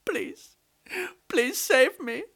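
high, wailing plea to the goddess; crying plea. A narrator's reading. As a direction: wail for the goddess to save you. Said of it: "started crying to the goddess".

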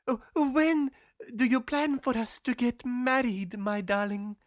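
put-on high girl's voice, hopeful; eager sweetheart. A narrator's reading. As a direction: ask your darling in a high, girlish voice. Girl asks about the wedding.

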